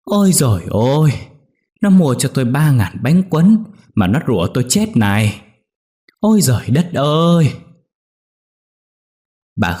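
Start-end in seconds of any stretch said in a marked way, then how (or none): 0.0-7.5 higher, louder old woman's mock lament; old mother-in-law throwing a tantrum, wailing at her daughter-in-law; theatrical wailing. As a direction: wail it like a fussing granny. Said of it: "lăn đùng ra ăn vạ".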